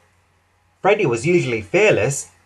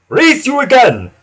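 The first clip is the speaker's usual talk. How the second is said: loud, high little-engine dare; high, forceful challenge; huffed challenge; 10 semitones higher, 7 dB louder than usual. A narrator's reading. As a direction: call out the rematch eagerly. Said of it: "huffed Skarloey".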